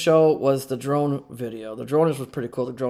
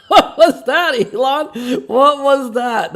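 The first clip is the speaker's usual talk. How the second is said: laughing mock question; 9 semitones higher, 7 dB louder, slower than usual.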